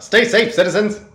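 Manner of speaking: high, loud female radio announcer; 1940s pulp announcer. Acted. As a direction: belt it like a pulp heroine. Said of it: "strange nineteen forties pulp female announcer voice".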